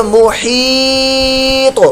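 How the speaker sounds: محیط with the long i drawn out very slowly; slow madd arid on محیط; stretched madd. Shown as a demonstration. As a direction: hold the ee in محیط. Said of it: "مد عارض".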